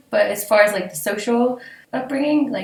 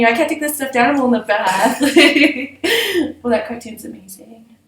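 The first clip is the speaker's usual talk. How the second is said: normal talking voice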